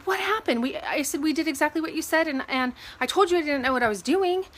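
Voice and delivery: mildly heated retelling of a complaint; retold frustration. Retold argument with the lender.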